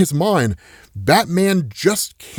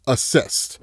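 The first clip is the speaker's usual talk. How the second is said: whispered answer; 4 semitones lower, 4 dB quieter than usual. Retold or imitated. Batman solves the riddle quietly.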